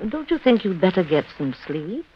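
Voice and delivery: hushed, soft bedside reassurance; soft soothing. Acted. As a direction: lull her to sleep gently. Sarah soothes the aunt.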